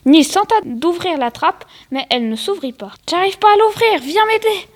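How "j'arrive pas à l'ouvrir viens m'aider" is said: high-pitched call for help; mildly panicked, acted plea; urgent plea. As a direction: get someone over to help you. Acted plea for help while trapped.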